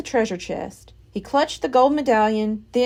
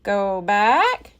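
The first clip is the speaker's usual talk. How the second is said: squawked; 2 semitones lower than usual. A narrator's reading. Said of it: "squawked Polly".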